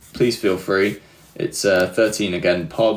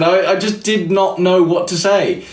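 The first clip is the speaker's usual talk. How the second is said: ordinary talk, nothing marked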